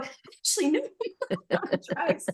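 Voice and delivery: high denial with a laugh; giggly denial. Retold or imitated. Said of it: "I go".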